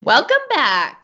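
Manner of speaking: loud, high welcome; excited. Acted. Cohost shouts greeting.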